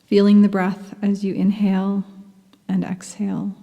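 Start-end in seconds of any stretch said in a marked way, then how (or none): none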